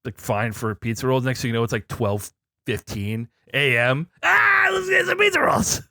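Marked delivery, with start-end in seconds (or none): 4.2-5.8 very high, strained mock yell; hammed-up high-pitched cry of temptation; excited late-night craving. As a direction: yelp it like a giddy late-night snacker. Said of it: "It was like, ah".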